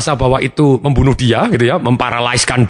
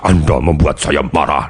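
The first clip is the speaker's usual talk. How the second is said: low slow accusation; 10 semitones lower, slower than usual. Acted. Repeats the accusing sentence.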